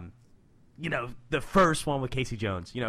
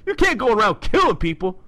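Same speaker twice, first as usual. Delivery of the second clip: loud, higher New Yorker Raph impression; nineties film Raph; 8 semitones higher, 8 dB louder than usual. Doing nineties movie Raph for the co-host, loud New York impression. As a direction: belt it out like the movie Turtle. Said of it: "I'll do the, the nineties film rap".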